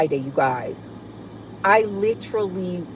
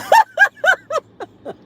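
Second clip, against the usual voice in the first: loud squealing cackle; real helpless laugh; high shrieking laugh; 7 dB louder than usual. Real, not acted. Laughing at comment.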